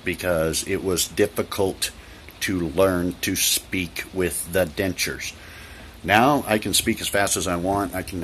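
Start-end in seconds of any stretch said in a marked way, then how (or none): 0.0-5.3 slow, deliberate words, each one placed with care; slow careful speech. Shown as a demonstration. Showing viewers how he first talked with new dentures, slow and careful. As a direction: speak slowly, concentrating on every word. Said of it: "so I would speak like this".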